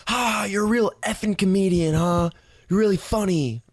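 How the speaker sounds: high-pitched imitation of her jeering; mock angry yelling. Angry woman jeering at Jake, mock sarcastic yell. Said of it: "so she starts yelling".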